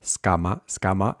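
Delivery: Scama with unaspirated k after the s; Scama, no puff on the k; S before cama. Shown as a demonstration. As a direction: slide from s into a muted k in Scama. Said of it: "imagine an S there".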